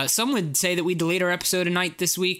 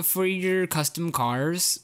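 ordinary talk

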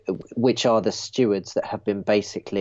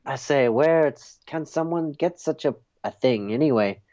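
put-on French accent; 3 semitones higher than usual. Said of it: "your French accent".

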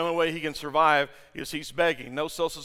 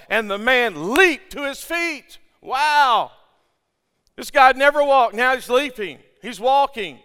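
loud, higher, exultant preaching; full-force preaching; 7 semitones higher, 9 dB louder than usual. Real, not acted. Pastor telling congregation of healed man, exultant and loud. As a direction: celebrate the miracle at the top of the voice.

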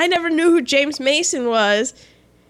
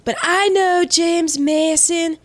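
James Mason; 3 semitones higher than usual. Acted. Answering co-host about his accent in a James Mason impression. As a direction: put on the actor's stretched, stylised speech. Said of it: "how to talk like James Mason".

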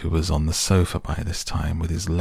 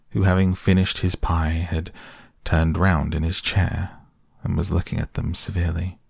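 usual speaking voice

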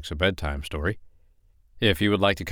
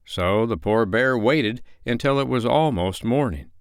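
ordinary delivery, nothing marked

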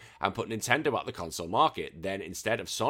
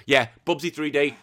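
ordinary talk

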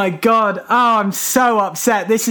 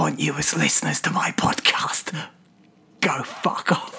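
whispered aside; 6 semitones lower, 7 dB quieter than usual. Acted. Aside insulting horse girl listeners.

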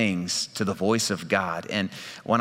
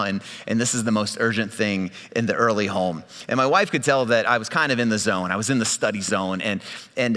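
ordinary delivery

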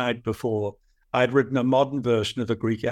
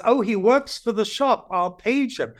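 higher, bright mock receptionist voice; young receptionist; 9 semitones higher than usual. Athena at the bookstore desk answering, lightly voiced. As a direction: play the cheerful desk clerk. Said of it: "She says".

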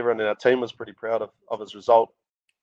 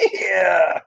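drawn-out celebratory yeah, pitched up; reliving a high, jubilant yeah; retold cheer; 7 semitones higher than usual. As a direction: cheer it as the team did. Retelling team cheering the result.

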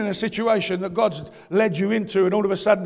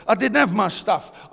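ordinary talk, nothing marked

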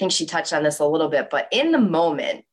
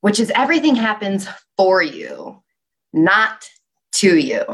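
for you, then not and to you punched louder; stress on not and to; 2 semitones higher, 4 dB louder than usual. Shown as a demonstration. Naming her next pillar, stressing not to you. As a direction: lean hard on not and to you. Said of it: "next pillar".

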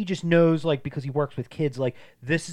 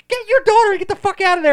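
high, loud yell at the screen; screaming at the TV; 13 dB louder, faster than usual. Retold or imitated. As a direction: scream the warning at the TV. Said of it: "I was, like, screaming at the T V".

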